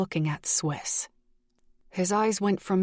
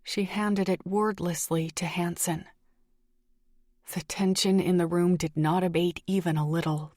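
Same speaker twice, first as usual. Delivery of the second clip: ordinary delivery, nothing marked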